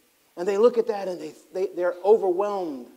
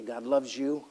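softer, lower tender blessing; tender reassurance; 5 semitones lower, 5 dB quieter than usual. Retold or imitated. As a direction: comfort a homeless person gently.

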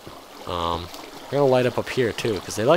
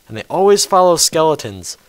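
exasperated rant; 6 semitones higher, 9 dB louder than usual. Real, not acted. Ranting about creepers in the cave.

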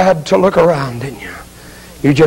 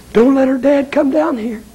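raised-pitch anguished begging; higher, distressed appeal; urgent plea; 5 semitones higher than usual. Acted. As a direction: plead it with rising desperation. Repeated plea, more urgent.

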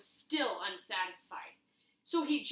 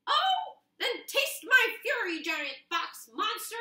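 much higher, louder battle cry; defiant little hero; 9 semitones higher, 8 dB louder, slower than usual. Acted. Paper defying the giant box monster, high battle cry. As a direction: fire back fearlessly.

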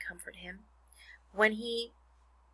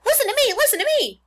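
very high, loud goose screech; screaming; 12 dB louder, faster than usual. A narrator's reading. Goose demands the pig's attention, screaming. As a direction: scream for attention over the hullabaloo. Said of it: "screamed the goose".

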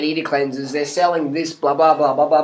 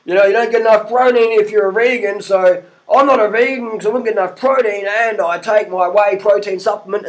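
mock YouTuber pitch; 4 semitones higher than usual. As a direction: parody a fitness guy plugging his own powder. Mocking fitness YouTuber sales pitch.

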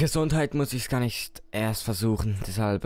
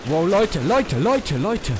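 excited; 6 semitones higher, 6 dB louder, slower than usual. Real, not acted. Excited call to viewers.

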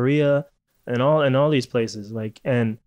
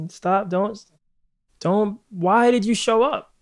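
exasperated rant; 6 semitones higher, slower than usual. Acted. Mock annoyed rant at guest.

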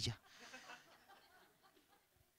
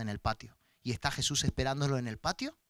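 ordinary talk

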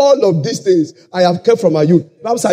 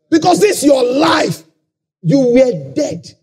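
preaching at full force; 4 semitones higher than usual. Acted. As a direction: hammer the point home to the church.

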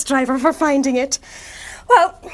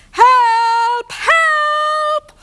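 high cries for help, higher; calling for help; 9 semitones higher than usual. Acted. Vehicles stuck, calling out.

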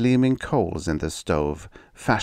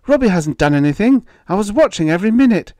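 high, loud, rapid defence; alarmed mother's fast outcry; crying out; 8 dB louder, faster than usual. A narrator's reading. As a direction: rush to defend the child at full voice. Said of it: "she cried".

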